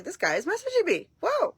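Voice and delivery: giddy disbelief, voice soaring on whoa; thrilled fan telling herself he messaged her; super excited. Retold or imitated. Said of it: "I got super excited".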